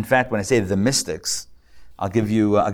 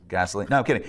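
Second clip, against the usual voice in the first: normal talking voice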